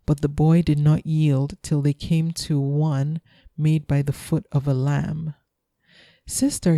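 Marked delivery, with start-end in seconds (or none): 6.3-6.8 higher-pitched call to his sister; a touch of exclamation; mild exclamation. A narrator's reading. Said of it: "he exclaimed".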